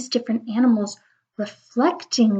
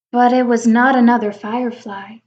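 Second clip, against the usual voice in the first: ordinary delivery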